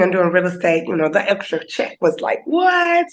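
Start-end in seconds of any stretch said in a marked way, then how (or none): 2.4-3.1 sudden high shout of disbelief; shocked high-pitched what; surprised what. Retold or imitated. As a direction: yelp it like the check stunned you. Reacting to the extra check.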